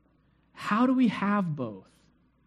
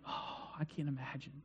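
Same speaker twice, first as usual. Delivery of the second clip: very soft, lower aside; hushed dread; 6 semitones lower, 19 dB quieter than usual. Acted. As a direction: say it under your breath, as if dreading it. Voicing shared dread of loneliness.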